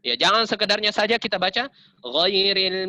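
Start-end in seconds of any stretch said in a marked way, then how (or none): none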